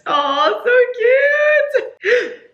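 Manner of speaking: high, loud gushing; delighted squeal. Real, not acted. Reader gushing at the happy ending, delighted squeal. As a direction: squeal it, overcome by cuteness.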